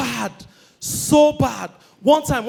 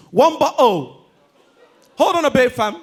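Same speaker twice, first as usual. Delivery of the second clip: put-on London accent; 3 semitones higher, 6 dB louder than usual. Returnee dealing with the waiter, London accent. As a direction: fake London lad talking to staff. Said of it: "The only thing he came with, accent".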